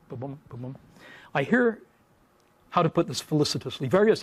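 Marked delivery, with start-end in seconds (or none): 0.1-0.8 soft thumping heartbeat; quiet ba-bum like a heartbeat; heartbeat sound. Retold or imitated. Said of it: "My heart's going boom".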